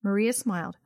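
ordinary delivery

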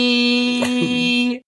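a shouted, high call of a name; Mia Goth impression. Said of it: "Do your impression for me".

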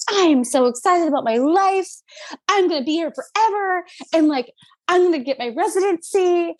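high, bubbly retelling of her dreamy self; giddy excitement. Retold or imitated. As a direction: gush it out, starry-eyed. Herself dreaming, quoted aloud.

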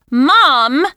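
loud high call after her. Acted. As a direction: call her back, annoyed. Daughter calls after walking mother.

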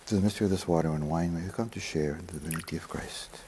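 hushed, private whisper; murmured prayer. Real, not acted. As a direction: pray it half aloud under your breath. Quiet private prayer of the priest.

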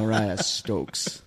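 slow drawn-out name, laughing. Acted. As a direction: stretch the name out slowly.

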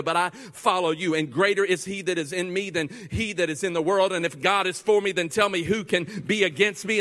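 fervent preacher's prayer growing loud; humble faith, building. Acted. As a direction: pray it out, climbing to a shout of trust. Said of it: "doubt from a position of humility begins to say".

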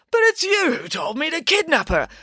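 high-pitched protesting complaint; complaining. Acted. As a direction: whine it as an aggrieved accusation. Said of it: "complained Hades".

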